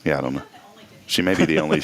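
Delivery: ordinary delivery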